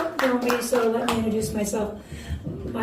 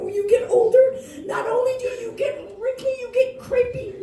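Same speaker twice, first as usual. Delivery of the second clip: mock crying; 10 semitones higher than usual. Mock lament about aging skin.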